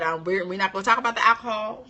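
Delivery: ordinary talk, nothing marked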